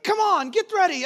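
put-on high, pushed yell at the kids; mock yell at kids. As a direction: rush the kids out the door. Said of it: "I start yelling at them".

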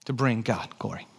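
normal talking voice

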